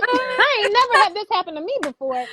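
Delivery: high, laughing through the words; giggling in happy disbelief. Real, not acted. Guest laughing to the host about a first-time surprise.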